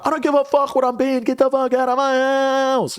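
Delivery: high-pitched, fast mock yell; exaggerated high voice yelling get out; retold yelling. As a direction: squeak it out fast, like a hammed-up rant. Retold yelling at her to leave.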